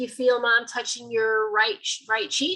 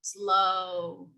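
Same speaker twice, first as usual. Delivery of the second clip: "slow" stretched out long; drawn-out slow. Shown as a demonstration. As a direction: drag the word "slow" right out. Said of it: "sometimes they talk slow".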